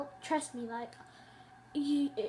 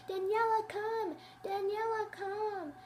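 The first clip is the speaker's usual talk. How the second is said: higher, calling across the yard; teacher calling out; 7 semitones higher, slower than usual. Retold or imitated. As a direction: imitate a teacher calling a pupil. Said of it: "pretend the girl's name was Daniela".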